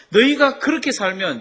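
the word 너희가 thrown out high and loud in mock rebuke; rebuking prophet. Prophet rebukes the people for disobeying, mock scolding. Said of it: "막 질책을 합니다".

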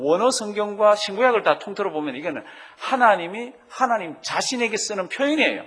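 normal talking voice

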